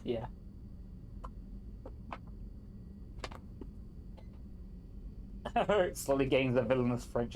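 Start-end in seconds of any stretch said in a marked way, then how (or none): 0.3-5.5 quiet fake laugh. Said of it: "Is that your fake laugh?".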